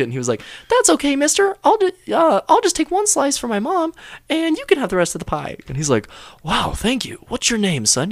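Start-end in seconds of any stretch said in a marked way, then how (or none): 0.7-5.6 put-on very high sitcom-child voice; little kid. Sketch kid offering pie to a homeless man, squeaky child voice. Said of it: "little kid with a Hitler mustache". 6.4-8.1 lightly voiced, higher sitcom stranger; grateful homeless man. Sketch homeless man thanking the boy, higher put-on voice. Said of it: "a homeless person took it".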